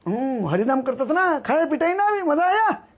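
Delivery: high, rising mock jeer; mocking taunt. Bad onlookers jeering at beaten Haridas, high-pitched mockery. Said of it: "ऐसे बोलते हैं".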